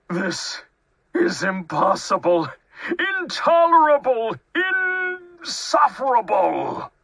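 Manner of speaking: outrage building to a high shout; angry, disbelieving cry. A narrator's reading. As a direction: hit each word separately, climbing in fury. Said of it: "cried Bear, shaking with anger and disbelief".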